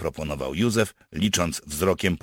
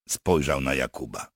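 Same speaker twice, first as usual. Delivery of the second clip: ordinary delivery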